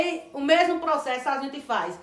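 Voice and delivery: ordinary talk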